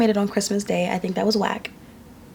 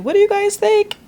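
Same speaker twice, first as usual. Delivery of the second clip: mock high question; 12 semitones higher, 4 dB louder than usual. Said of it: "They're like".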